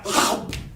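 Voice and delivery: sharp breathy gasp in; quick demo gasp. Shown as a demonstration. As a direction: suck the air in fast like grabbing a fly. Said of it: "take a deep breath in".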